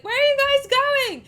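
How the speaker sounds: high, loud mock yell; retold panicked yell. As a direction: yell after people leaving you behind. Acts out person left behind.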